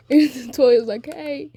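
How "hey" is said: high, protesting call; calling after someone. Acted. Toya to siblings walking off, calling after them.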